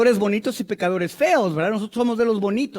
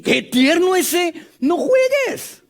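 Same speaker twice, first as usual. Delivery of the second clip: high, slow gushing voice rising higher; mock gushing; 6 semitones higher, slower than usual. Preacher imitating people who gush at a softened person. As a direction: gush in disbelief at how sweet he is. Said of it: "hay otra gente que te dice".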